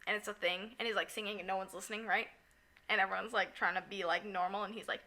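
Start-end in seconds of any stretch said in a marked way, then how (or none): none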